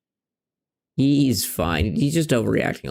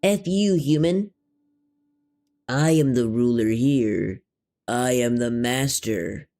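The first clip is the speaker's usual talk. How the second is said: voicing the cat; slower than usual. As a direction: play the cat as a smug little tyrant. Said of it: "Kitty says".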